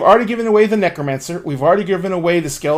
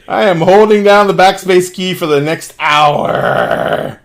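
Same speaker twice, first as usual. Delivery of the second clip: laughing; 3 semitones higher, 6 dB louder, slower than usual. Real, not acted. Laughing at own mistake.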